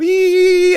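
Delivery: high squeaky toad call imitation; a tiny high toad cry. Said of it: "the American toads go".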